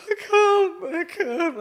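high, loud, breaking cry of despair; exhausted on the mountain, crying out high and loud. Retold or imitated. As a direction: wail that you can't go on. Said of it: "I was just started crying".

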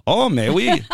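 high, loud mock German visitor; badly faked German accent drifting toward French; muddled German accent. Host plays Germans coming over, muddled German accent. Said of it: "like the Germans come over like".

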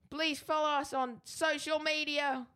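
Eggsy. Acted. Co-host reads out contact details as Eggsy, badly faked South London accent. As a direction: struggle through in a put-on South London voice. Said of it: "This has gone really terrible".

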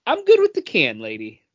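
put-on very high, loud bratty kid refusing grandma's dish; mock kid refusal. As a kid refusing grandma's homemade cranberry, high and bratty. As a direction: squeal it like a picky child. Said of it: "would always be like".

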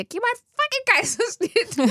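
high-pitched, hammed-up birth-room scream; mock scream. Mother-to-be imagining herself screaming at birth staff, mock scream. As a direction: play at screaming a demand, half laughing. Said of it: "jeg skriger".

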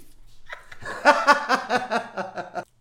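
big high burst of laughter; loud laugh. Real, not acted. Laughing at her own makeup joke, loud and high. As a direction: crack up loudly.

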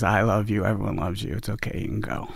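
soft, fading loving goodbye; tender farewell. Retold or imitated. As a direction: let him go gently. Wife's farewell to dying husband.

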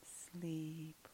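hushed, slow, soft sign-off; soft whispered podcast outro. Real, not acted. The ghostess thanks her listeners in a soft hush.

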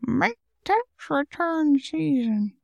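put-on slow, high creaky old man; slow high-pitched old geezer voice. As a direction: mimic a creepy old cartoon man. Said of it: "that sounded like that guy from Family Guy".

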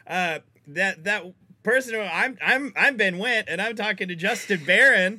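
high, loud announcer energy; hyped host intro. Acted. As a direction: pump up the show intro.

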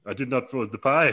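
a light mock Dutch accent on "I did not throw the pie". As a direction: deny it in a hammed-up Dutch accent. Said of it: "'cause he's Dutch".

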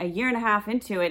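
ordinary delivery

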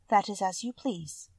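usual speaking voice

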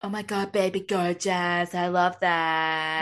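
long drawn-out, loud gushing catchphrase; loud mock-gushing drawl; Lisa Barlow. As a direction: stretch every word out, hammed-up impersonation. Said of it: "Lisa Barlow".

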